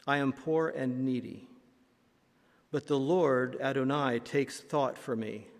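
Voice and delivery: ordinary delivery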